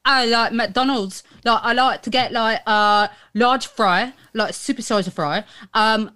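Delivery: put-on British chav accent, halting. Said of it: "in that accent".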